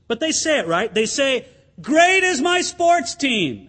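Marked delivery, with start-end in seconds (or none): 1.8-3.7 slow, higher cheer, stretched out; sports fan chanting for the team; mock loud cheer. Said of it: "they say it really loud".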